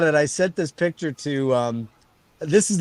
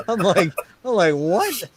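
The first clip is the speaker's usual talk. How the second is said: high-pitched, just out of a big laugh; amused disbelief; 6 semitones higher than usual. Real, not acted. Friend retells his reply to the Santa guess, laughing disbelief. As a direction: squeak it out through amusement.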